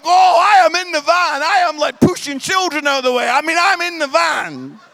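loud, frantic telling; hammed-up scramble for safety; preacher hamming up his panicked rush into the van; comic panic. Real, not acted.

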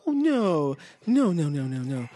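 high, tearful repeated no's, fading off; Georgina from Get Out. Retold or imitated. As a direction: imitate her frozen, creepy denial. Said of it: "the chick in Get Out".

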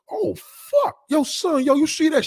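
mock guys hollering from a car; excited catcall. Men in a car spotting a woman, excited.

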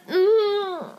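muffled groan rising into a high wail; wordless, long, high howl of frustration; exasperated wail. Real, not acted. Exasperated at the bad movie.

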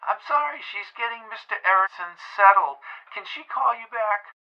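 small squeaky phone voice. A narrator's reading. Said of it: "A squeaky voice answered the phone".

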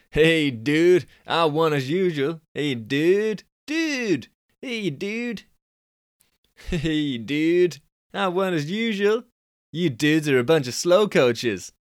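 Californian dude. Shown as a demonstration. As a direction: pitch way up into a Californian dude brag. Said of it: "he's a Californian kind of guy".

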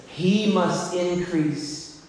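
very slow, hushed quoting of the verse; slow reverent hush; slow and soft. Shown as a demonstration. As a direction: let each word land, quietly. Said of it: "Here's what it says".

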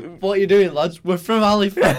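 high, loud laughing mimicry of the lads; giggling send-up of a nervous excuse; mocking laugh. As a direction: squeal the alibi while laughing. Mocking the lads' alibi.

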